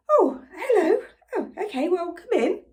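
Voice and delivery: high, flustered mock hello; surprised greeting. Greeting a different lady at the door, acted surprise. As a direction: greet an unexpected caller, pitched high. Greeting an unexpected stranger, retold.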